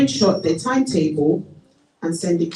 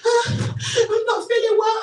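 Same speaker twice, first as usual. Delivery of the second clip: high, chirpy fake-sick voice; faking sick. Student phoning her teacher to fake illness, high and chirpy. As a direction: pretend to be ill and do it badly. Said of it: "you don't sound like you're not feeling well".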